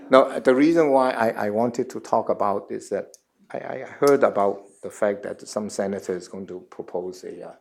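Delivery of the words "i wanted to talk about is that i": normal talking voice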